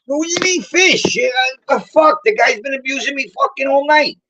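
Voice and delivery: high-pitched, indignant complaint; exasperated protest. Retold or imitated. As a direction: protest at being singled out. Protesting being singled out.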